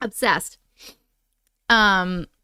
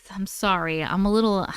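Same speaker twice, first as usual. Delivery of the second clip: ordinary talk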